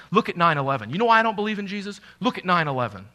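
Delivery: loud, fast scoffing challenge; scoffing skeptic. Retold or imitated. Preacher voicing a doubter who points at nine eleven, loud scoffing. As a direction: sneer it like a doubter citing tragedy. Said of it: "what you hear people say".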